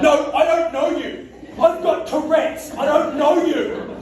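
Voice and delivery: high, loud, slow blunt denial; awkward weirdo. Retold or imitated. Comic explaining Tourette's to a stranger, loud slow awkwardness. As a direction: spell it out stiffly like a weirdo. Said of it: "be a weirdo and be like".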